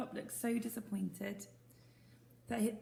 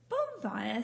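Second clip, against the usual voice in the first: puzzled; 5 semitones higher, 6 dB louder than usual. Acted. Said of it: "said the boy".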